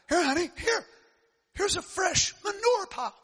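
high drawn-out mama hen; mother hen voice. Acted. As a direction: fuss over the feast like a proud hen. Mother hen offering manure.

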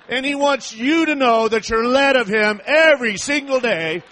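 loud, impassioned sermon climax; full-force preaching. Real, not acted. Preacher telling friends they are led of God daily, full-force preaching. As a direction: drive it home to the congregation at full voice.

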